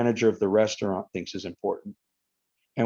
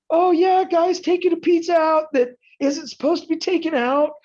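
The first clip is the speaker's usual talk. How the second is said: high, loud hammed-up police call; mock cop on the radio; 3 dB louder than usual. Pretend pizza police calling in the stolen pizza, mock. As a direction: ham up a cop reporting a crime.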